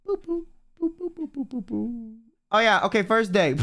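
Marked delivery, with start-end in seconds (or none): 0.1-2.1 soft, high boops stepping down; little high-pitched boop noises; idle booping. Real, not acted. Streamer searching Twitter, softly booping to fill time.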